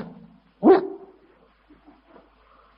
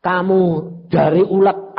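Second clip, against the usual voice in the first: ordinary delivery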